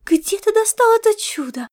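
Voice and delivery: high, enraptured question; delighted amazement. A narrator's reading. Blissful star cat asking about the treat, delighted amazement. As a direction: marvel at it, voice lifted high.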